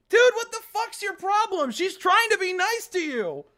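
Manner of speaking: high, loud, exasperated scolding; angry outburst. Real, not acted. Player angrily defending Sayori from Monica. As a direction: snap at her for being rude.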